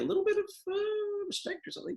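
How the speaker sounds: very high, tiny voice; comically high, tentative suggestion; squeaky hedging. Acted. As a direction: tiptoe through it in a high squeak. Jokingly hesitant, squeaky plea for respect.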